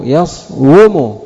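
يصوم said slowly as yas-wu-mu; original form yaswumu. Shown as a demonstration. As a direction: stretch يصوم with the w sounded. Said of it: "مثل يكتب". (يصوم على الأصل: يَصْوُمُ)

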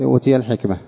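normal talking voice

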